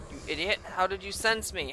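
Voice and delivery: low, quiet muttered question; subdued incredulous question; low disbelief. Real, not acted. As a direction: ask it under your breath, put out. First take, muttered at enemy.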